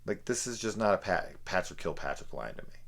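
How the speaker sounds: normal talking voice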